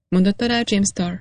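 usual speaking voice

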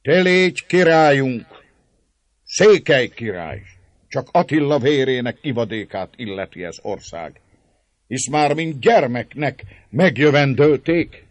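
loud, high slurred toast; tipsy old Székely proclaiming; drunken old man. A narrator's reading. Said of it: "a részegség minden jeleivel emelé poharát".

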